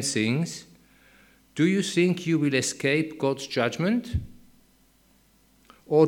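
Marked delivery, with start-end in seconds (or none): none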